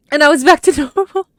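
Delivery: nervous laugh. Real, not acted. Room changes back, laughing.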